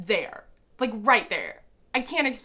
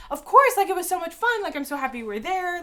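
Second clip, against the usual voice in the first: higher, louder, bubbly; bubbly Taylor; 10 semitones higher, 6 dB louder than usual. Retold or imitated. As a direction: answer like a cheerful star. Voicing Taylor's cheerful answer.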